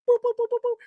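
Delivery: high, clipped boop-boop beeps; phone beeps. Retold or imitated. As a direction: tap the beeps out like a phone line. Imitating phone line beeps.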